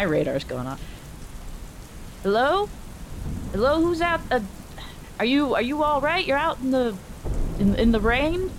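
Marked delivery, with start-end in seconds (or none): 2.2-8.5 raised voice calling into the rain. Acted. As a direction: call out to strangers across the street. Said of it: "yell out first".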